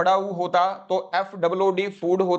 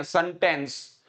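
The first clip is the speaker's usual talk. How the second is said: सेंटेंस as Haryanvi 'sun-tens'; Haryanvi style; slower than usual. Retold or imitated. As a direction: mimic a Haryanvi सेंटेंस with 'sun' start. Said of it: "Haryanvi style में बोलते हैं". (सेंटेंस, Haryanvi 'सनटेंस')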